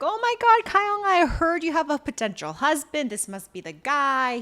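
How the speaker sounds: high, loud, gushing exclamation easing down; excited greeting. Retold or imitated. Village chief meeting her potential husband, excited.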